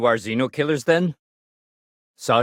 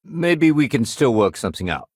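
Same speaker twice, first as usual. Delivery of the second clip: ordinary talk, nothing marked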